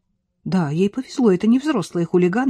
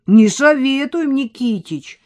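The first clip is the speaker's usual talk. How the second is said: louder, slow together; chorus warning; 7 semitones higher, 6 dB louder, slower than usual. Acted. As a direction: call out as a gang. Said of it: "дружно откликнулись подростки". (хором, громко)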